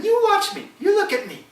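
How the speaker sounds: the wife calling him to watch, high and loud. Acted. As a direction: demand his eyes, high and loud. Wife voiced in folk tale.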